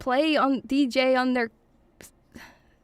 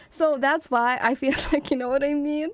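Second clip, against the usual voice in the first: normal talking voice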